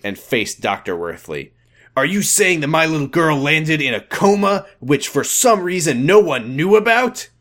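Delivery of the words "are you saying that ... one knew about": higher, louder, outraged father demanding answers; angry father. Acted. Father confronts doctor about his daughter's coma, outraged. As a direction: push the question up at the doctor.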